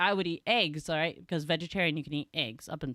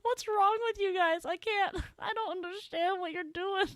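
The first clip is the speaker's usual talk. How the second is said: very high squeaky mock despair; helpless high-pitched retold complaint; laughing dismay; 11 semitones higher than usual. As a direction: wail it, choked by laughter.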